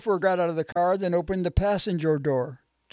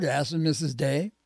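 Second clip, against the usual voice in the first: ordinary delivery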